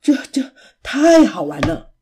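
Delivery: panting and laughing, gasping out the words; breathless after the fall into snow; out of breath. Acted. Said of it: "琪琪喘着气说". (气喘吁吁地笑着说)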